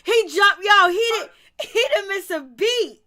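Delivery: can't hold the laugh in. Real, not acted. Host cracking up telling co-host how Puma jumped, laughing.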